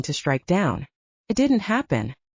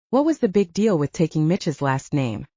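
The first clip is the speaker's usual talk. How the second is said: plain reading voice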